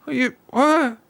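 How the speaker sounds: high-pitched, drawn-out bewilderment; slow, incredulous high voice; baffled chat viewers. Retold or imitated. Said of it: "people in my chat were like".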